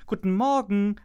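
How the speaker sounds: high, loud morning greeting; a cheerful call to the pig. A narrator's reading. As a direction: call it out brightly across the mud. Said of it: "rief Kiss Kiss".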